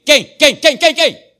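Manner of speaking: rapid-fire loud quem, rising; insistent calling. Acted. Pastor urging the congregation to answer, loud and insistent. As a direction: hammer the question at the crowd.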